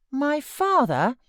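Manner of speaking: put-on high, slow, puzzled boy's cry; a bewildered child crying out; perplexed child. A narrator's reading. Child told of a father, crying out in perplexity. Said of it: "he cried in strange perplexity".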